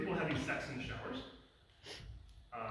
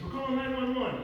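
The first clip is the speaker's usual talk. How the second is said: high, loud emergency call-out; imitated urgent shout; 10 semitones higher, 8 dB louder than usual. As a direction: shout it out like a nurse in a crisis. Second nurse calls out in emergency.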